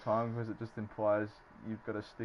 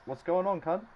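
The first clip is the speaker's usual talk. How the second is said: casual greeting; 7 semitones higher, 4 dB louder than usual. Acted. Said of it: "some people just say".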